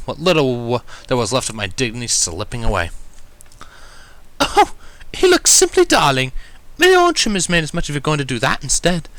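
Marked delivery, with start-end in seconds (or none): 4.4-9.1 delighted and fanciful; swooning posh lady; whimsical. Acted. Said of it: "in a whimsical tone".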